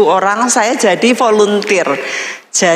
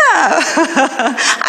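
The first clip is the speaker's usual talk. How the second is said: high half-laugh half-cry; laughing sob; 5 semitones higher than usual. Real, not acted. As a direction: let a laugh break into a sob. Laughing crying sound.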